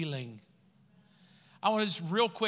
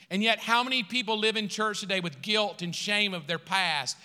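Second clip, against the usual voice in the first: usual speaking voice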